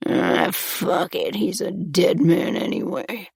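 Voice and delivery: mumbled. A narrator's reading. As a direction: mutter it to yourself, a rough captor. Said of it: "our captor mumbled".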